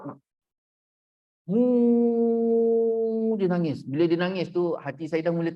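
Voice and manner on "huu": long, high, loud mock sobbing howl; imitated loud wail. Counsellor showing how the wife cried her hardest, imitated loud wail. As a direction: hold one steady wailing note, imitating tears. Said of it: "dia nangis sekuat-kuat".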